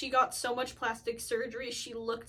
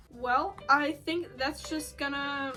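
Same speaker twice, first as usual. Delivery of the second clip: ordinary delivery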